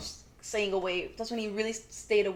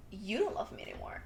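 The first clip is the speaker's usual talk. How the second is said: hushed retold plea; 9 dB quieter than usual. Retold sister's hurt plea to brother.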